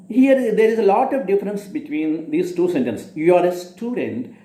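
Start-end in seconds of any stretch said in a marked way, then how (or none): 3.1-4.3 "You are a student," falling on student as a statement. Shown as a demonstration. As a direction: let "You are a student," drop at the end. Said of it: "I am saying with a falling tone".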